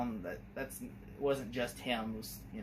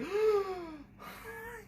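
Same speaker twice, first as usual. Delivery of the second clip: laughing gasp. Retold or imitated. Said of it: "his face lights up like".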